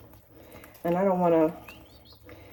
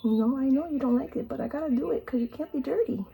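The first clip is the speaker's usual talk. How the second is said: higher and softer coaxing; gentle pet scolding; 7 semitones higher, 4 dB quieter than usual. Real, not acted. Owner softly telling her bird it must be cleaned. Soft high baby talk scolding.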